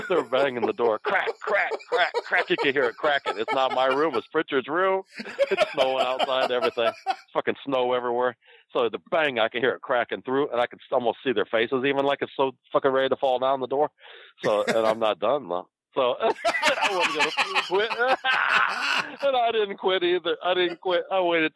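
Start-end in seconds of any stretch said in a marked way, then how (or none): 1.0-2.5 high mock cracking noise, crack crack; door cracking sound. Retelling the door breaking, high mock cracking sounds. Imitating the door being hit. 15.9-21.5 high giggling through the words; can barely get it out for laughing; laughing while talking. Real, not acted. Telling his friend the story, laughing through it.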